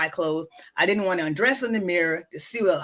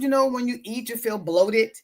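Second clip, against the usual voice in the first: usual speaking voice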